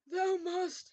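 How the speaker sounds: high villain yell. A narrator's reading. Voldemort ordering Ebony, high yell. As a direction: insist with a grand shout. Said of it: "he yelled".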